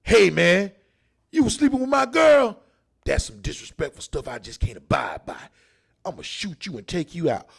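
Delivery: mock gangster, loud at first; a hint of a courteous hitman; polite shooter. Shown as a demonstration. Comic playing a shooter explaining himself before firing. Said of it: "I go up and shoot somebody like this".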